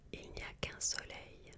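usual speaking voice

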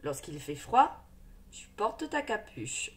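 ordinary delivery

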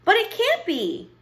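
put-on very high child's voice; protesting child. Abilene rejects the storyteller's ending, protesting. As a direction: object in high-pitched dismay.

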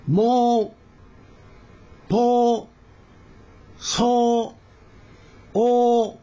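slow, separated Korean syllables; syllable drill. Shown as a demonstration. Teacher leading pronunciation practice, one syllable at a time. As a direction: say each syllable alone with a clear mouth shape. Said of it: "日本語の、お、発音に割と近い".

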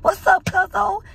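high, eager hustler voice; mock cousin hustling. Vlogger imitates her cousin begging for five dollars. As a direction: beg like a street hustler. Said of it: "be like".

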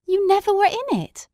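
high, incredulous question; can't believe what he hears; astonished Watson. A narrator's reading. Watson to Holmes, high incredulous question.

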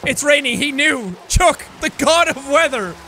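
normal talking voice

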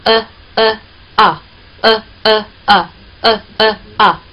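呃呃啊 chanted slowly in rhythm, mouth opening on 啊; slow rhythmic drill 呃 呃 啊; vowel drill. Shown as a demonstration. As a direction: lead the class through 呃呃啊. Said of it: "是这样发音啊".

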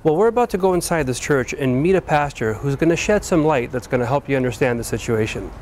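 ordinary talk

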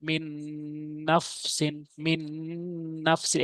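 "মিন নফসিন" with a long hummed n; ghunna recitation. Shown as a demonstration. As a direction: hold the n of "মিন" through the nose before "নফসিন". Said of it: "গুণনা করে পড়তে হবে".

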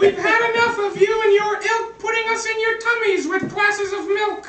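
high squeaky cookie voice; high cartoon cookie voice; cookie twins. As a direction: make demands as tiny cookies.

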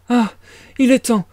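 high, flustered urging; frantic mother. A narrator's reading. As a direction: panicky mama hurrying her daughter along. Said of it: "d'un air éperdu".

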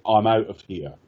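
normal talking voice

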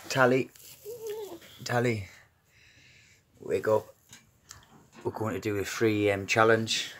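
gentle, hushed coaxing; soft wake-up voice. Real, not acted. Gently waking sleeping children.

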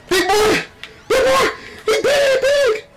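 excited shout. Real, not acted. Cheering big win.

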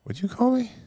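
high squeaky mock indignation; mock outrage. Mock outrage at Cockney jab.

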